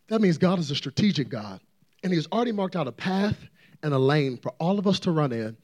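ordinary talk, nothing marked

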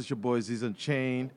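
usual speaking voice

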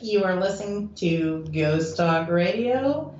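usual speaking voice

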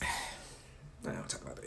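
a sigh, then a low, soft, slow breathy no; sighing refusal. Retold or imitated. Shutting down the friend's question, sighed and low. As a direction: let it fall away, tired of the topic.

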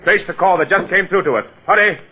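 urgent. Acted. Detective urgently calls operator.

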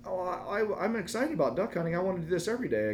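a hint of an eager kid; excited little boy. Son telling dad he loves duck hunting, light child voice. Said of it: "he goes".

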